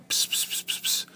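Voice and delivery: soft unvoiced psst sounds, barely there; whispered psst. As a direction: hiss psst psst into his ear like a teasing child. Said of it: "he would whisper in my ear".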